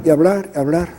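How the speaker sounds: normal talking voice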